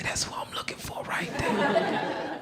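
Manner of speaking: soft, whispered aside; retold whisper. New inmate finally spotting the man he seeks, whispered.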